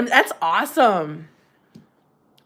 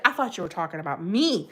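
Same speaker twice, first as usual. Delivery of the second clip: ordinary talk, nothing marked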